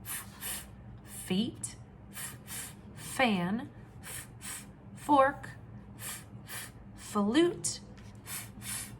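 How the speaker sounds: voiceless f hissed on the lip; teacher sounding out f. Shown as a demonstration. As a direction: blow a short f f. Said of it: "Can you read these words".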